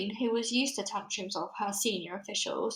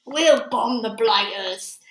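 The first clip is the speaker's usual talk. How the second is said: loud, slow military bark; shouted; 8 dB louder, much slower than usual. A narrator's reading. Air Force chief shouting to bomb the giants, loud and slow. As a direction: bellow it like an eager commander. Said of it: "shouted the head of the Air Force".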